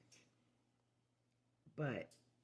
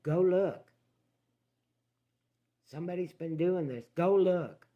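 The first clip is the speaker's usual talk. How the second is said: forceful order; 5 semitones higher, 9 dB louder than usual. Retold or imitated. Ordering brother to the barn.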